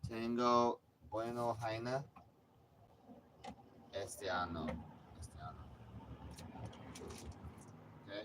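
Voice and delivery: a joking bit of broken Spanish; mock Spanish. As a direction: play to the Spanish-speaking audience. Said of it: "That's for my Spanish speaking audience".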